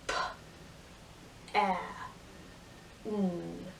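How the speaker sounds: each sound slowly apart. Shown as a demonstration. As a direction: split pan into single sounds. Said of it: "teacher sounds out pan".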